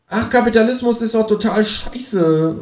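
high, frustrated outburst; high-pitched sore loser; exclaiming kangaroo. A narrator's reading. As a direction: cry out in annoyance as the kangaroo. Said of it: "ruft das Känguru".

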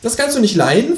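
high, falsely innocent kangaroo voice; mock-innocent talking animal; sanctimonious. A narrator's reading. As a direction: ask with feigned innocence, high-pitched. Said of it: "fragt das Känguru scheinheilig".

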